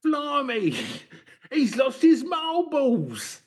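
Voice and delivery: very high, squawking soap character; mock EastEnders local. Local gossips about the Russian newcomer, squawky EastEnders voice. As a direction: caricature a nosy soap gossip.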